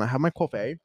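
ordinary talk

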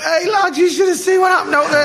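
yelling the news across the changing room; excited shout. Retold or imitated. Gazza bursting into the changing room, hammed-up excited shout.

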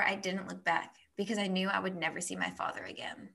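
soft, measured novel reading; story narration. Acted. Friend reading the daughter's goodbye to her father aloud, soft narration. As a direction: read the passage quietly and evenly. Reads passage from the book aloud.

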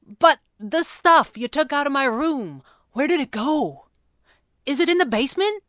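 high, urgent but held-in questioning; barely contained agitation. A narrator's reading. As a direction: press for answers, keeping a lid on it. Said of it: "fighting to keep a lid on things".

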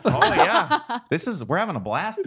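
high, laughing Minnesota accent; playful Fargo Minnesotan. Shown as a demonstration. Host having fun with riff, Minnesota accent. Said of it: "nail a Minnesota accent".

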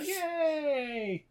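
high sarcastic yay; mock cheer. Podcaster mock cheering the rescue, high pitched. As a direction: cheer in a hammed-up kid voice.

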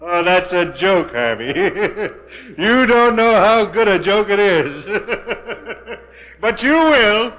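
rising, high manic laughing; mad laughter. Acted. Doomed man laughs madly.

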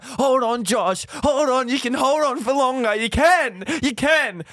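lightly voiced mates, high and slow encouragement; mates urging on. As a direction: coax him to hang on. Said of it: "they're like".

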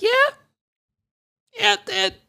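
very high, squeezed mock voice; high-pitched mocking imitation; Kamala Harris. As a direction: squeak it out as a caricature of a pleased speaker. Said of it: "Kamala Harris is like".

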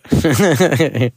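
high, rippling chuckle; laugh. Real, not acted. Dad amused that she talked herself tired, real chuckle. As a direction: chuckle at your own story. Laughs at his own story.

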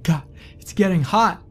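high, loud, panicked pleas; desperate begging. A narrator's reading. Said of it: "began begging".

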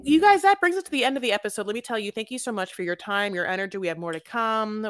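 loud, high host outro; podcast sign-off. Acted. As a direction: announce the wrap-up with energy.

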